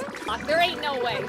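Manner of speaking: ordinary delivery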